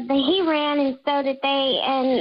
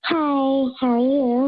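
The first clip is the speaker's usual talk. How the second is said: disguised voice through a voice changer; altered, masked caller voice; slower than usual. Real, not acted. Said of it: "even with a voice changer".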